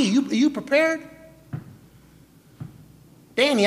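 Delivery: ordinary delivery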